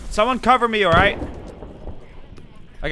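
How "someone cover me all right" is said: urgent call for cover; cover me shout. Real, not acted. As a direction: shout it over gunfire. Calls for cover under fire.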